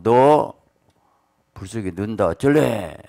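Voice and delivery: furious king's threat. Retold or imitated. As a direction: bark the threat down at them like an enraged king. King threatens the three men. (분노한 왕의 위협)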